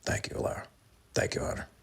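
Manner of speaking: soft whispered thank-you. Real, not acted. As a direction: whisper the thanks under your breath. Quiet repeat of thanks.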